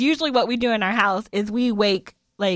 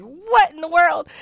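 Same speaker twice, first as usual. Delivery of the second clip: startled; 7 semitones higher, 5 dB louder, slower than usual. Retold or imitated. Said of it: "I was like".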